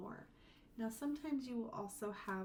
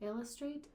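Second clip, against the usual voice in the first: "Illustrate" with IL pitched higher; stress on IL; 6 semitones higher than usual. Shown as a demonstration. Teacher moving the emphasis, first syllable stressed. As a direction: hit the start of "Illustrate". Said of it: "feel the emphasis move".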